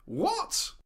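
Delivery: high shocked yelp. Acted. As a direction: squeak it out in disbelief. Shocked at granny's news.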